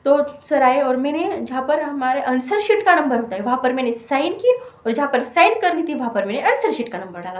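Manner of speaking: usual speaking voice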